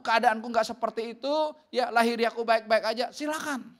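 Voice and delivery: loud, high, insistent preaching; forceful rebuke. Retold or imitated. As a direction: build one long urgent warning, clause upon clause. Preacher retells his forceful rebuke. (teguran keras dengan nada tinggi)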